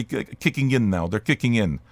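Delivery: low, settling-down delivery; mushrooms kicking in. Real, not acted. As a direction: voice sinking low. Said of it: "Once I start talking like this, they kick in".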